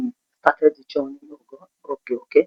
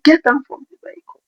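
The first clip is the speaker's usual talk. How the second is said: a driver shouting them off his vehicle; furious driver; 11 semitones higher than usual. Retold or imitated. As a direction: order them out like an enraged driver. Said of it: "He was so furious".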